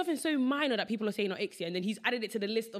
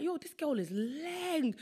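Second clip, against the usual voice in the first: mocking inner voice; 7 semitones higher, much slower than usual. Acted inner voice, slow.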